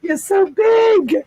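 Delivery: high, slow cooing at a dog. Real, not acted. Greeting a visiting puppy.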